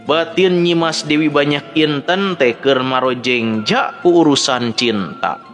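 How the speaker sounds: usual speaking voice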